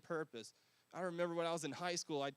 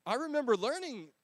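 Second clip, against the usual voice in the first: louder restart; 7 semitones higher, 10 dB louder than usual. Real, not acted.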